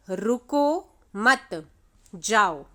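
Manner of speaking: slow "रुको मत", a clear pause, then "जाओ"; pause after मत. Shown as a demonstration. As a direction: stretch "रुको मत" and stop before "जाओ". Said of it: "कॉमा लगा दिया". ("रुको मत" के बाद ठहराव, फिर "जाओ")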